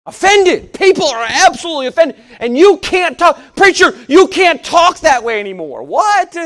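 loud, high, fiery preaching; preaching at full force. Acted. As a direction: hammer it home from the pulpit. Preacher rails at offended culture.

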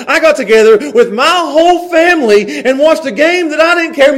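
high, loud fired-up sermon; full-force preaching. Acted. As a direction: preach it out to the room with fire.

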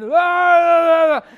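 long, high, very loud "ah" cries; drunken yelling. Retold or imitated. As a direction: bellow like rowdy drunk Marines. Drunk Marines yelling at preachers.

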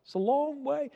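ordinary delivery

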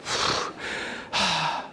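soft, unvoiced pouting sigh; a long, hushed huff; sulky sigh. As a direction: let out a sulking breath. Ahab sulks after complaining.

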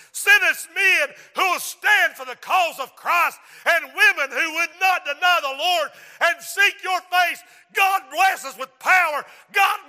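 loud, rhythmic pleading to God; fervent preaching prayer. Real, not acted. Preacher begging God to send revival, fervent prayer. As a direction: cry out each petition, building in force to a shout. Leads impassioned altar prayer.

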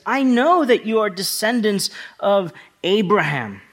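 high, forceful preacher reading; emphatic scripture quote. Acted. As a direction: declare it firmly to the challengers. Preacher voices Jesus answering his challengers.

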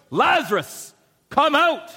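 high, loud call into the tomb. Acted. Jesus calling Lazarus out of the tomb, loud high cry. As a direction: call the dead man out with full force. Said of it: "Jesus called out in a loud voice".

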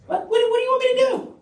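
high-pitched, rapid stammered question; incredulous mock question. Preacher mimicking a strange question to a blind man, incredulous. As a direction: throw it out fast and high, as if baffled. Acts out Jesus' question mockingly.

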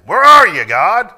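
"Where are you, God?" shouted high and loud, accusing; accusing attitude. Shown as a demonstration. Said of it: "Same words, different attitude".

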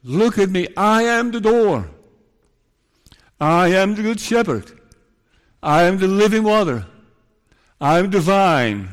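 loud, high proclamation; Christ. Acted. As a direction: declare each title boldly from the pulpit. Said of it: "Or with words like".

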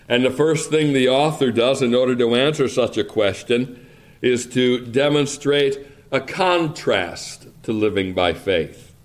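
ordinary talk, nothing marked

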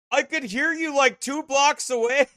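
high-pitched mimicking quote; Carl. Acts out Carl's remark on loudness.

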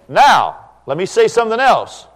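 loud, high preaching to the congregation; full-force preaching. Acted. Preacher warning the congregation about lying, in a loud, high preaching voice.

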